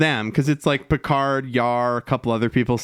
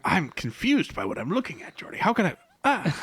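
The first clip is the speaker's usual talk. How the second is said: quiet confused Picard; 5 semitones higher, 6 dB quieter than usual. Retold or imitated. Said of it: "it was just Picard going".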